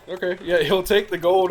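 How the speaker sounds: ordinary talk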